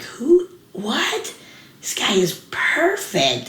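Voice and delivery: reliving first seeing Farley; starstruck. Retold or imitated. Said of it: "I was like".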